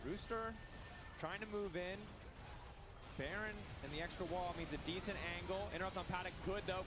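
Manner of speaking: high, loud, rising hype call; excited play-by-play. Acted. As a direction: call the teamfight like it's a final. Live play-by-play of a fight.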